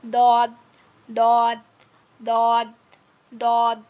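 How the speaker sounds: heavy dad sound from the tongue's back edge; Arabic letter dad. Shown as a demonstration. Teacher drilling দদ to the class, slow and deliberate. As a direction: press the tongue edge to the upper gums for দদ. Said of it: "আস্তে আস্তে হয়".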